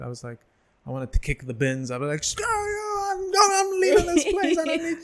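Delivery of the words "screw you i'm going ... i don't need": very high, squealed send-off; hammed-up gleeful rant in a high voice; mock triumphant outburst. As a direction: squeal it like you're finally free. Acts out gleeful farewell outburst.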